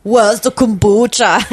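put-on slow, heavy Austrian accent; a weak stab at the Austrian accent; Arnold Schwarzenegger impression. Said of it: "I can't do it".

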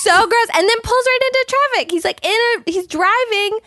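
high, loud, disbelieving retelling; shocked and excited. Real, not acted. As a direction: tell it with rising outrage.

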